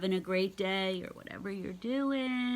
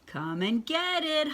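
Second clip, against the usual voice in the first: hollered; 7 semitones higher than usual. A narrator's reading. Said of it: "hollered Froggy".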